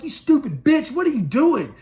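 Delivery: high, angry shout through glass; retold furious shout at a guard; furious yell at the guard. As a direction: scream it at her through the glass.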